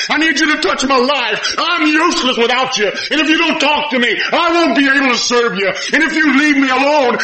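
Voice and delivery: high, loud desperate plea; pleading Peter. Acted. Peter pleading with Jesus on the shore, high and desperate. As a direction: beg Jesus for help. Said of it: "He said".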